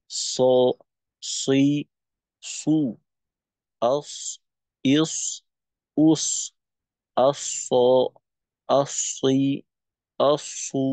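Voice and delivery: heavy emphatic স with fatha, kasra, damma; ṣad letter sounds. Shown as a demonstration. Teacher demonstrating the heavy ṣad sound to students. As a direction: say স, সই, সু thick and full-mouthed. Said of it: "আরেকবার উচ্চারণ বলি".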